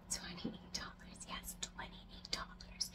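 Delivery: slow, barely audible whisper; whispered aside. Real, not acted. Vlogger confiding a plant's auction price to viewers, whispered. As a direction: whisper it slowly, like a juicy secret.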